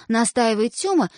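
usual speaking voice

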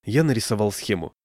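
ordinary delivery

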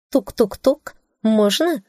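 high, playful sing-song knocking; playful knock call. A narrator's reading. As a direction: chirp it like a cheeky knock.

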